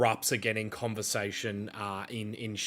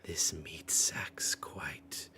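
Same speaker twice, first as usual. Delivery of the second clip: low, slow, whispered relish; Frollo; 4 semitones lower, 8 dB quieter, slower than usual. Acted. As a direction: whisper it as if savouring a morsel. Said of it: "Frollo steps in and goes".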